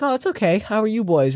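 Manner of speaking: high, sweet mock old lady voice. Imitating the friendly lady who chatted to the boys, high and sweet. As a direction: pitch up into a kindly stranger's voice. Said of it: "And she's like".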